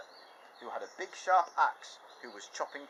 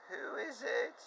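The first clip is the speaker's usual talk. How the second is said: put-on much higher, old granny voice; Grandma's voice; slower than usual. Grandma answering the door, high old voice. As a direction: quaver like a frail grandma. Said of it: "And Grandma said".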